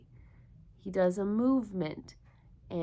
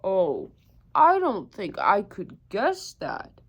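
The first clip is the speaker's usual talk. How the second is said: big hare voice; 7 dB louder than usual. Acted. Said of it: "said Big Nut Brown Hare".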